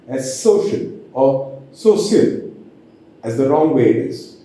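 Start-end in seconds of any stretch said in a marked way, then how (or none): 0.4-2.5 "social" slowly, with a hissed s in place of sh. Shown as a demonstration. Teacher showing the wrong way, S for SH. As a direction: say "social" as "so-see-ul", tongue between teeth. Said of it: "as S sound".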